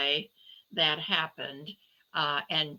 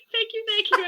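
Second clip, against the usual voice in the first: giddy mock thanks; 6 dB louder, faster than usual. Mock bow after laughing.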